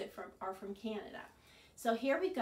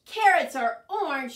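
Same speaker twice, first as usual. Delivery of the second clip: loud high indignant objection; shouting back at Rabbit; shouted; 4 semitones higher, 14 dB louder than usual. Acted. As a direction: protest loudly at the idea. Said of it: "shouted Bird".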